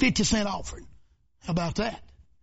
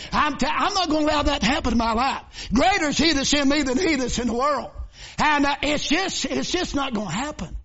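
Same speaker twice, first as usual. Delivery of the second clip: higher, driving preacher's cadence; rising preaching; 5 semitones higher than usual. Acted. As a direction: build the sermon back up.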